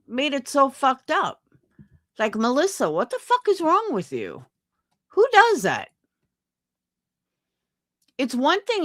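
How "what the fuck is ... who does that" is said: outraged. Real, not acted. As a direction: let the outrage burst out. Ranting at Melissa on stream.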